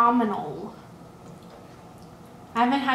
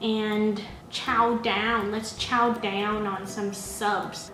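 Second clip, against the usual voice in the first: slow, lower stretched chow; slow drawl; 3 semitones lower, 3 dB quieter, much slower than usual. Real, not acted. Host lingering on chowing down, slow and lower. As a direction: stretch out the words lazily.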